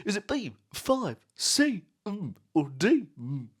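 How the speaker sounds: high, hammed-up quiz host voice; mock game show host; Chris Tarrant impression.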